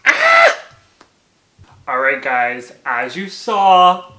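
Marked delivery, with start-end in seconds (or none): 0.1-0.6 short, very high, loud shriek; sudden shrill scream; fan scream. Real, not acted. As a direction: let out a piercing squeal. Scream after finishing the book.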